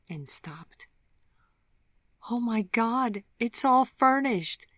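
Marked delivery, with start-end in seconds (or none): 2.3-4.6 high, loud gasp of delight; loud, high disbelief at a gift; overwhelmed joy. Acted. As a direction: burst out in amazed joy. Vivian sees the furnished apartment.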